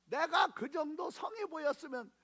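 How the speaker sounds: high, loud, crying accusation; a mother demanding her son's exam pass; tearful furious shout. Retold or imitated. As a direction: demand it through tears, voice cracking. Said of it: "내가 막 따지듯이 한 거죠".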